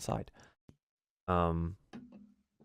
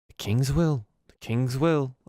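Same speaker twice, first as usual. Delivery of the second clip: higher, louder goblin voice; raised-pitch goblin, resigned shrug; shrugging goblin; 7 semitones higher, 5 dB louder than usual. Acted. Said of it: "just kinda shrugs and goes".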